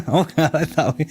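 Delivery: laughing it off as the words come out; a real laugh spilling into the words. Real, not acted. Answering a chat question while laughing.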